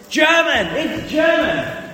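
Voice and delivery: big, drawn-out game-show reveal of the answer; triumphant quiz host shout; excited reveal. Acted.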